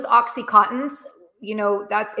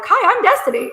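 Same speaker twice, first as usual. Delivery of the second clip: high, loud chirpy self-introduction; bright, much higher and louder imitation of a friend's cheerful hello; bubbly greeting; 13 semitones higher, 8 dB louder than usual. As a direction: greet like an eager friend meeting a stranger. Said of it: "she just immediately is like".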